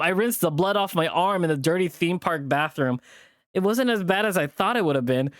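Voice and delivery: plain reading voice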